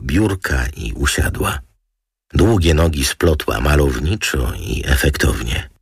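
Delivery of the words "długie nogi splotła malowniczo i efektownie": plain reading voice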